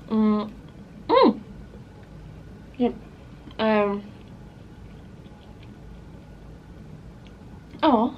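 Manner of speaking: chewing while talking; muffled by food; mouth full. Real, not acted. Seen 2: bites nugget at -4, cheeks full and chewing through labelled words.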